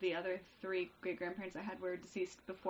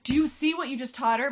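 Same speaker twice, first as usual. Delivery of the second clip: loud, higher mock scolding; imitated scolding grandma; 5 semitones higher, 11 dB louder than usual. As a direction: act out a grandma telling someone off. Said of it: "my grandma's like".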